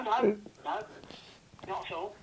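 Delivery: ordinary delivery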